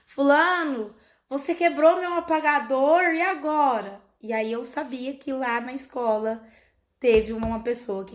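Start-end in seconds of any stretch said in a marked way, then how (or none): 0.0-4.0 a hint of a scolding teacher, a bit higher; lightly voiced teacher telling a pupil off. Mother imitates the teacher scolding the pupil, lightly higher. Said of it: "a frase que provavelmente a professora usou".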